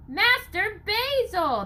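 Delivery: high, slow pleading cry; pleading call. Acted. Nathaniel pleads with Basil to hurry, high drawn-out cry. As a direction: beg him to move, drawn out. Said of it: "Nathaniel pleaded".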